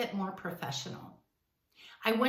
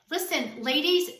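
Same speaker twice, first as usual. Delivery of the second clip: ordinary talk, nothing marked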